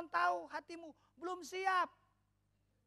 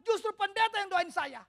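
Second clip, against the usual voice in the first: louder, higher indignant retort; mock indignant; 5 semitones higher, 9 dB louder than usual. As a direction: huff it like an offended churchgoer. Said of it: "seringkali kita berkata".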